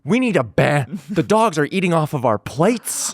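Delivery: high mock pundit voice, alarmed; hammed-up cable news outrage; Fox News pundit. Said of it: "your impression of, like, a Fox News pundit".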